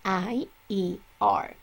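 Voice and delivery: I E R said slowly, letter by letter; slow, deliberate spelling of I E R; spelled slowly. Shown as a demonstration. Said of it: "we are going to add".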